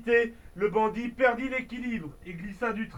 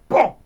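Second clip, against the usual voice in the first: coup de feu crié; 4 semitones higher, 10 dB louder than usual. Acted.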